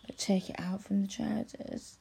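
breathy intimate whisper; hushed whispered instruction. Acted. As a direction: whisper it close in his ear.

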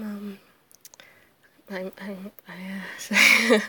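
ordinary talk

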